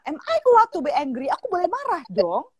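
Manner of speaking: high imitated child asking permission; pleading daughter. Daughter asks mother if she may be angry, imitated high plea. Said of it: "terus dia nanya".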